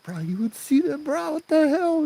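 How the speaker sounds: very high, shocked squeal; hammed-up disbelief at top pitch; freaked out. Two friends stunned by auntie's glowing hand, very high and freaked out.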